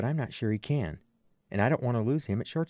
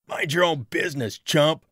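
raised, loud jeer; heckling shout; 5 semitones higher, 6 dB louder than usual. A narrator's reading. Someone in the crowd to the man, loud heckling. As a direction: holler an insult from the crowd.

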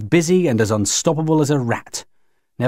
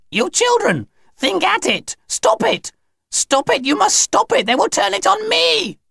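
very high, frantic cries; high-pitched hysterical alien panic; terrified begging. Acted. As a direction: shriek a desperate plea to stop it. Alien aunt begs children, terrified.

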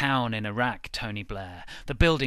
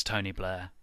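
usual speaking voice

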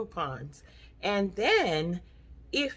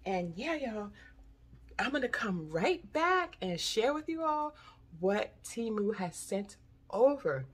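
ordinary delivery

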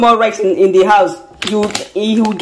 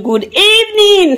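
drawn-out high greeting; 10 semitones higher, slower than usual. Acted.

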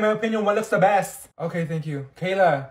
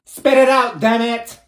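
impatient snap; 3 semitones higher, 6 dB louder, slower than usual. Acted. Impatient teacher snapping.

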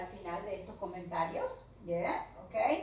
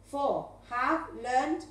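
slow model read; much slower than usual. Shown as a demonstration. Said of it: "teacher models verb forms".

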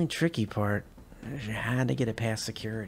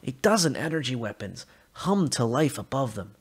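plain reading voice

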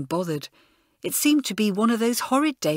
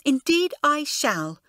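indignant; 7 semitones higher, 4 dB louder, slower than usual. A narrator's reading. Elizabeth storms out.

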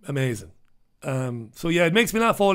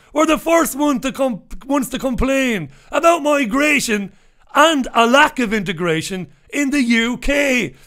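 rising, louder, higher outrage; radio host venting at expats, getting louder and angrier; indignant rant; 7 semitones higher, 5 dB louder than usual. Real, not acted. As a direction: build into a furious rant about the hypocrites. Ranting about hypocritical expats.

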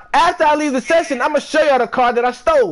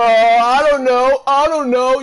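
mocking echo; 4 dB louder, slower than usual. Mimics rival's slow answer.

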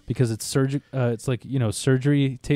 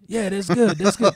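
lightly imitated pimp, pitched up; higher voice, hint of a movie pimp; Pinky impression; 6 semitones higher than usual. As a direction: imitate the coworker's approving patter. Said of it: "he sounds exactly like him".

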